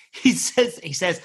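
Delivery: usual speaking voice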